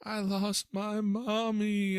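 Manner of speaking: put-on high, slow, tearful small-boy voice; tearful little boy. Lost little boy to the man who found him, high and tearful. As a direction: play the lost child, crying it out slowly. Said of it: "he says through his tears".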